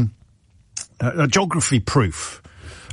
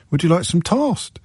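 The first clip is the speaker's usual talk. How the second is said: higher, plummy "toast" as tahst; posh headmaster's wife; 7 semitones higher than usual. Retold or imitated. Presenter imitating the headmaster's wife again. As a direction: lay on the posh vowel. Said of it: "how this person pronounced toast".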